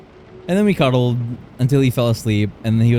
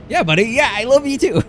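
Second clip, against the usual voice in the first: much higher, sing-song mock of her own clueless answer; mock clueless reply; 12 semitones higher than usual. Retelling her clueless answer to Chris, mock sing-song. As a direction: parody how oblivious she sounded.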